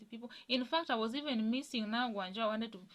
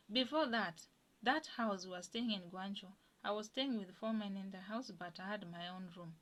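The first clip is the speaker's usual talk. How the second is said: usual speaking voice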